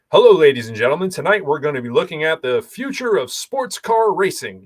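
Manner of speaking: accent-free American broadcast voice; newscaster without Boston accent. Shown as a demonstration. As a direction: open the evening news, every R pronounced hard. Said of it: "I could have zero Boston accent".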